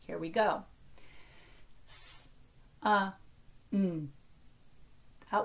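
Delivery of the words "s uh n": single sounds "S", "uh", "n", paused apart; separate phonemes. Shown as a demonstration. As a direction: sound out "S", "uh", "n" one by one. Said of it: "we're blending phonemes".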